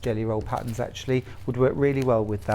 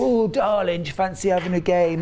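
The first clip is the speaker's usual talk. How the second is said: hint of cheeky lads, pitched up; mock come-on, higher and quick; imitated pub lads; 4 semitones higher than usual. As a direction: lightly act out a chatting-up challenge. Lads in pub challenge sister-in-law.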